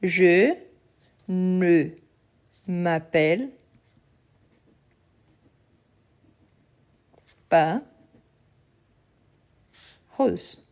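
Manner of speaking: je ne m'appelle pas Rose, long pauses between the words; slow negation demo. Shown as a demonstration. Teacher building the negative sentence word by word. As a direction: lay out each word separately with long gaps. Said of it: "dans la même structure".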